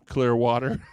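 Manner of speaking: loud, hammed-up Minnesota accent; exaggerated Minnesota accent on a punchline; imitated Minnesota accent. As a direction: belt it out as a mock Minnesota local. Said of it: "sounded just like the, the ladies".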